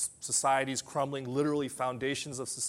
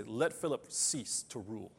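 calm reassurance; 6 semitones lower, 8 dB quieter than usual. Retold or imitated. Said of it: "Literally, he would say".

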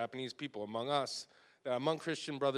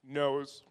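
knows punched louder on its own; stressed knows; 2 semitones higher, 6 dB louder than usual. Real, not acted. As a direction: land hard on knows. Repeated word for emphasis.

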